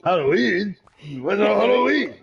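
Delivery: mock Japanese accent, high pitched; exaggerated Japanese accent. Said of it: "my Japanese accent".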